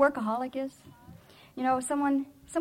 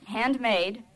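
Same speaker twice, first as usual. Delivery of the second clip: normal talking voice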